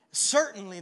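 ordinary delivery

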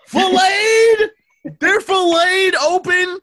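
a friend hears customers are filleted, shocked. Real, not acted. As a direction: screech it in disbelief, slow at the end. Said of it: "shocked at filleted".

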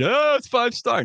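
high, mocking snooty voice; mocking snob. Mocking Miami students who call sandwiches gourmet, high sneer. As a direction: sneer it like a pretentious foodie. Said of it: "You throw a bunch of Doritos".

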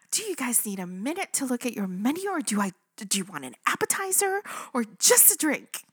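high, gushing, bursting with energy; hammed-up perky welcome; over-bubbly server. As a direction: imitate an overexcited server, squealed hello then rattling on. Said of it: "even more bubbly".

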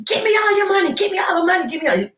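high, pushed demand; mock robber yelling at the teller. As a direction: bark it at the teller. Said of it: "yelling and screaming at her".

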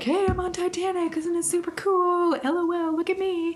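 high, bubbly show-off voice; excited tourist voice. Retold or imitated. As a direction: brag like a giddy passenger. Voicing passenger's excited message.

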